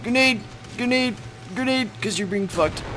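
high urgent grenade warning; grenade warning yell. Real, not acted. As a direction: call out the grenade.

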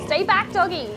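high, loud warning to an animal; nervous warding-off. Real, not acted. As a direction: call out to keep the beast away.

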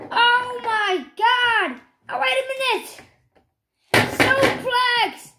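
high, loud live commentary, shouting the big moments; excited play-by-play. Acted. Gamer calling the Royal Rumble finish live, excited play-by-play. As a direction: call the match like a hyped ringside announcer.

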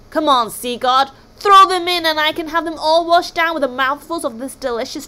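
high, loud monster demand; roaring Kraken. A narrator's reading. Hungry Kraken ordering the sea god, roaring. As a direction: bellow greedily for your meal.

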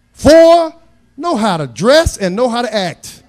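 high, loud pulpit cries on each number; shouted preaching. Acted. Preacher listing rules for his daughter's suitor, shouting. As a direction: call out the rules like a sermon peak.

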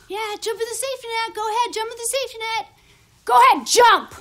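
high-pitched egging on, building to loud; insistent mock encouragement; goading. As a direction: urge them on, punching the final word. Them urging him to jump.

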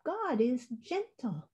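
soft, delicate bird-like chirp. A narrator's reading. Robin joins the argument about God, soft chirp. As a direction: chirp it gently and softly. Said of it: "chirped the robin".

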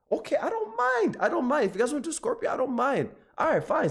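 high, sulky I-don't-mind voice; mock-resigned. Real, not acted. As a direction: pretend you don't care, but you do. Giving in to chat's pick.

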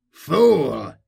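sharp, commanding shout; angry cry. A narrator's reading. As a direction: cry out in rebuke at a bungler. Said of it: "cried a voice".

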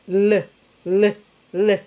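isolated "L", repeated in separate pulses; tongue-tip L. Shown as a demonstration. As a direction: repeat a plain dark l sound. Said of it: "es la L catalana".

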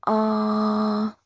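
"Ah" long, loud and even; high level tone. Shown as a demonstration. Teacher has class repeat "Ah", high level tone. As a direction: hold "Ah" steady and strong. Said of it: "The first tone is very high level".

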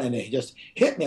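ordinary talk, nothing marked

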